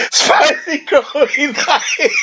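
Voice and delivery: high, slow and cracking up; dissolving into real laughter; laughing. Real, not acted. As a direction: barely get the words out for laughing. Cracks up at suggested title.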